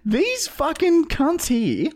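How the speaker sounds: high, slow, baffled put-on voice; squeaky cartoon voice doing YouTube; YouTube algorithm voice. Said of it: "'Cause then YouTube's like".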